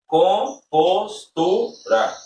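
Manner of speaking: "compostura" very slow, split com-pos-tu-ra; syllable by syllable. Shown as a demonstration. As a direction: break "compostura" into four separate beats. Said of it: "quatro sílabas".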